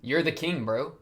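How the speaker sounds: ordinary talk, nothing marked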